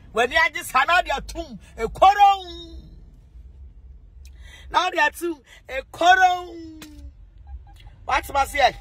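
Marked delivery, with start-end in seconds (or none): 1.8-2.5 shouted. Acted. Mock quarrel cry. 5.7-6.6 crying. Acted. Mock quarrel cry.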